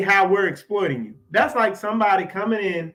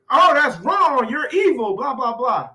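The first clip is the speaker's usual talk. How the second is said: mock outraged critics; 5 semitones higher, 5 dB louder than usual. Mocking critics' reaction.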